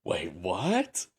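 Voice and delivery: mock-puzzled double take, low start; sarcastic disbelief. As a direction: feign shock with a rising question. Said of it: "I'm being sarcastic".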